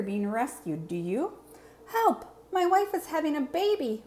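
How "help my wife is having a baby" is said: high frantic shout for help; panicked call for help. Acted. An expectant father calling for help, frantic. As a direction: yell it out in a rush of panic.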